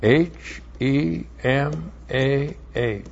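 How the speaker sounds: "H E M A H" spelled letter by letter, slow and soft; spelling out letters. Shown as a demonstration. Teacher spells a Hebrew word for the class, slowly. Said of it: "That's B Sheva".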